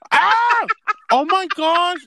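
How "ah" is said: high, loud mock scream. Friend reenacts screaming at the bug in the apple. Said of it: "you started screaming".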